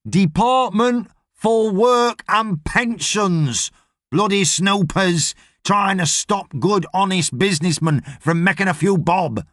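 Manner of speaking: slow, high, over-enunciated boss voice; exasperated boss. Acted. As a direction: spell it out slowly as the aggrieved boss. Boss lectures the workers.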